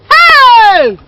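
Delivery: very loud, high drawn-out cry; shocked exclamation. Acted. Dramatic exclamation over shocking gossip.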